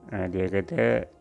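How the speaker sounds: ordinary delivery, nothing marked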